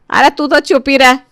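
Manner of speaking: loud, irritated shush; cross mother-in-law snap; snapping. Acted. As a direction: shut them up sharply. Mother-in-law silences the other daughter-in-law.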